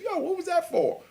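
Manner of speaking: high, startled what was that for; startled protest. Retold or imitated. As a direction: caught off guard, demanding why.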